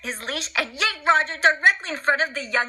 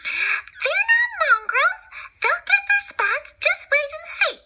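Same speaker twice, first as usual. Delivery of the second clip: much higher, slow indignant protest; Nanny exclaiming. Acted. Nanny defends the puppies against Cruella, high indignant protest. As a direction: stand up for the puppies. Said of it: "Nanny exclaimed".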